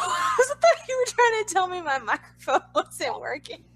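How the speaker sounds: talking through real laughter; giggling while explaining the mix-up; laughing. Real, not acted. Laughing at misunderstanding.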